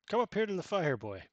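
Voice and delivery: loud, high friendly call; hearty invitation. Acted. Camp boss calling the boy to the fire, hearty. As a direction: beckon the boy warmly to the fire.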